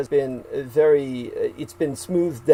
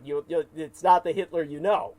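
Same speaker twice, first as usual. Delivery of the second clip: normal talking voice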